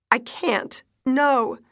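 high, loud refusal; distraught. A narrator's reading. Wife refusing to hear doctor's bad news, distraught. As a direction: cut in, panic climbing.

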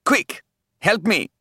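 high, drawn-out cry for help; urgent call. A narrator's reading. Snake calls to Nisha while under attack, urgent. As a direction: call out in alarm. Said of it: "The snake called to Nisha".

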